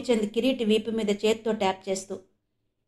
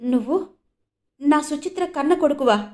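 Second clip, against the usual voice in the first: trembling voice; 5 semitones higher than usual. A narrator's reading. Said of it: "వణుకుతున్న గొంతుతో".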